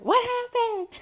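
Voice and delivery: high, loud startled cry; mock alarmed question. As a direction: call out in sudden high alarm. Coworker rushes in alarmed.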